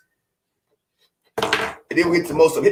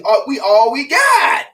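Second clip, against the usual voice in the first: rallying shout; 8 semitones higher, 5 dB louder, slower than usual. Real, not acted. Rallying cry.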